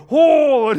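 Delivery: high, loud startled "oh"; a grandson's yelp at the sight; grossed-out kid's cry. Retold or imitated. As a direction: shriek like a shocked little boy. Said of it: "And Jonah's going".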